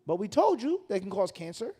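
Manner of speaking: normal talking voice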